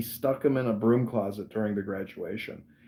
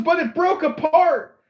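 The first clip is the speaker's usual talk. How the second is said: high, loud and slow wail; recruit shouting excuse; 8 dB louder than usual. Retold or imitated. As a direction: imitate a recruit yelling his explanation. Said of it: "he goes, he goes".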